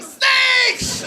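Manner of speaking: piercing high, loud mock scream. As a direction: shriek it in hammed-up terror. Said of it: "when you scream".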